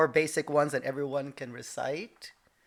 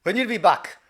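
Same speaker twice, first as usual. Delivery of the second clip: imitating dad, higher and louder; dad's loud accented question; imitated Japanese dad; 5 semitones higher, 9 dB louder than usual. Dad asks son leaving for college, imitated accent. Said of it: "he's like".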